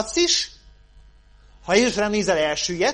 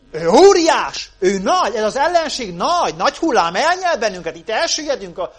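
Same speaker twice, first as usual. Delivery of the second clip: mock alarm; 4 semitones higher, 8 dB louder than usual. Voices Israel's dread of Goliath.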